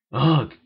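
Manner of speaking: loud disgusted groan; a loud fed-up groan. A narrator's reading. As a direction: let out a big groaning ugh. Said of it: "groaned Nova".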